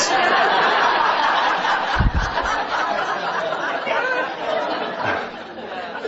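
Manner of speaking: breathy high laughter at the joke; real airy laugh; high unvoiced laugh. Real, not acted. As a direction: laugh off the punchline. Laughs at own punchline.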